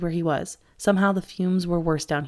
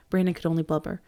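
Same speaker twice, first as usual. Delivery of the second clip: usual speaking voice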